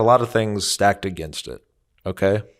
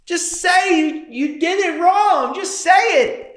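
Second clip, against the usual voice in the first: exasperated plea. Retold or imitated. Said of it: "So you're just sitting there like".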